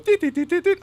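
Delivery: phone ring sound. Retold or imitated. Imitating a phone ringing.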